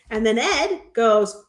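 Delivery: ordinary talk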